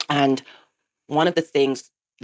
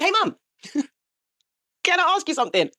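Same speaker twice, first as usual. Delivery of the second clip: much higher, mock son's voice; son interrupting mum; 12 semitones higher than usual. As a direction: pipe up high as an interrupting son. Acting out son bursting in.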